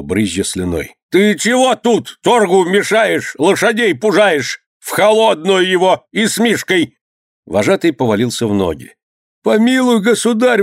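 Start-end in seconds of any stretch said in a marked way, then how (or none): 1.1-7.0 high, slow scolding tirade; angry shouting. A narrator's reading. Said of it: "закричал, наконец, на него". 9.4-10.6 high-pitched begging cry; pleading wail. A narrator's reading. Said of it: "вопил он".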